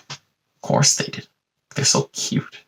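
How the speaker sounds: soft, near-whispered fondness; quietly doting on them; hushed aside. Real, not acted. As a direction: drop to a breath for an affectionate aside. Fond aside after the story's punchline.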